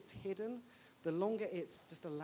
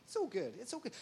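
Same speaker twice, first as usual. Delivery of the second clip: ordinary talk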